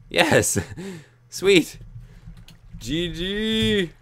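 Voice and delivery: high, loud triumphant whoop with a laugh; victory cheer. Real, not acted. Gamer cheers after another player dies in the match, triumphant whoop. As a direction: celebrate the win. Celebrating a kill.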